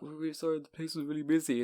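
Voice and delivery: soft, meek retold apology; apologetic staff. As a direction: voice the staff softly making excuses. Retold staff apology to angry customer.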